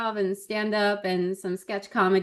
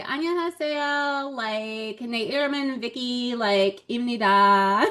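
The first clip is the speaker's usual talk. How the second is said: put-on fast, bubbly Valley Girl Korean with a high lilt; 3 semitones higher, faster than usual. On the talk show, playing up a Valley Girl in Korean. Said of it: "I just put in Valley Girl stuff".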